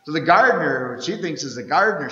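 ordinary talk, nothing marked

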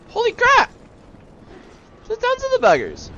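very high, loud squeal; alarmed outcry. Real, not acted. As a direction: squeal at a sudden swarm. Suddenly sees swarm of enemies.

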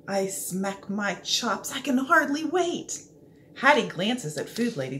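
usual speaking voice

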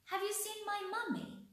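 a duckling asking for its mummy in a high voice; little duckling voice. Acted. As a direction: ask like a tiny lost duckling. Duckling asks animals for mother.

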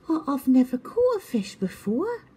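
high, puzzled and innocent; confused little owl voice. A narrator's reading. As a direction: stammer it in wonder. Twit amazed.

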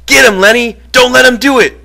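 very high, full-force urging yell. A narrator's reading. George on his feet urges Lenny to fight, yelling.

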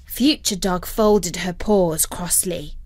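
plain reading voice

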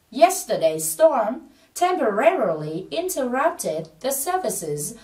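slow, evenly spaced English read-aloud; model sentence for practice. Shown as a demonstration. Teacher asking students to speak along, slow model reading. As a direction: draw each phrase out so learners can follow. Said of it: "tập nói cùng mình là".

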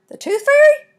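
high, loud, rising disbelief; astonished question. A narrator's reading. Brother popping out of the chicken house, asking in disbelief. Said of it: "Bodine asked".

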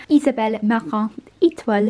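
brand name in a French accent. Shown as a demonstration. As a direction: say it the French way, with a Canadian French tinge. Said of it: "if you want a French accent".

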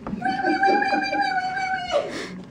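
very high, repeated panicked squeal; piercing run of piggy wees. A narrator's reading. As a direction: squeal like piglets fleeing. Pigs flee squealing.

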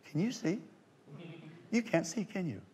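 high, soft mock questioning; hushed suspicious question. Actress quietly asks the magician if he's blind, hushed and suspicious. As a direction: imitate her discreet, puzzled probing.

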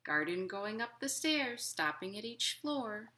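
gentle, lilting picture-book reading; reading to children. A narrator's reading. Librarian reading a picture book to children, lilting. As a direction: read it like storytime for little kids.

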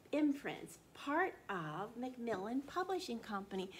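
usual speaking voice

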